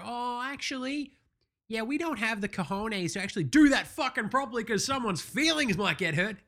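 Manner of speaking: high-pitched, mocking imitation of wimps; mock backtracking. Mimics organisers backing out.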